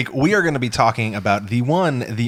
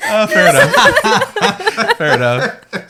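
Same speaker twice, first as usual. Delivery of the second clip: laughing; 7 dB louder than usual. Real, not acted. Laughing at the pick.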